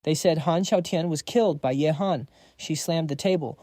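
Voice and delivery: usual speaking voice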